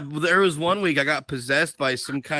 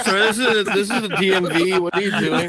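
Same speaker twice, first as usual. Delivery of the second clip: lightly acted, raised-voice protest; indignant onlooker; 5 semitones higher, 4 dB louder than usual. Imagined bystander to the man vomiting, mock indignation. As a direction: play a mildly scandalized bystander. Acts out onlooker scolding him at DMV.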